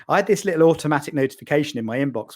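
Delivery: ordinary talk, nothing marked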